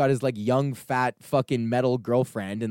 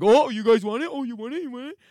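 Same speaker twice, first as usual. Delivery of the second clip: mock teasing guard; 11 semitones higher, 3 dB louder than usual. Mimicking guard teasing the crowd.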